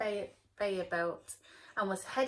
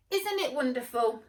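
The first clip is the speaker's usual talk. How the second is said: loud happy lolly; loud delighted lolly; 4 semitones higher, 8 dB louder than usual. Acted. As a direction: share the joy.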